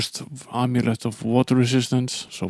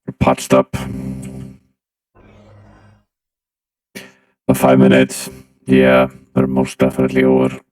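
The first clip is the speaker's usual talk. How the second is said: deep pitched-down robot voice; 8 semitones lower than usual. Acted. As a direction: talk like a voice-changed robot. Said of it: "Did you not like the robot voice".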